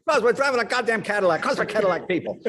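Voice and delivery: loud, high, sarcastic yell; hammed-up furious father; angry dad. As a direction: snap at his wife. Parents fighting in car.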